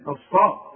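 كالصاء loud, with a thick emphatic ص, not an س; كالصاء with the ص pressed heavy; emphatic sad. Shown as a demonstration. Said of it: "مش كالسريع".

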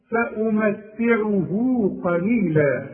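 "فأمتعه" recited slowly with the final hu held two counts; lengthened vowel. Shown as a demonstration. Teacher showing how to join the words, lengthened vowel. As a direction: draw out the "هو" ending of "فأمتعه". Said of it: "وإذا وصلت هنديها حركتين".

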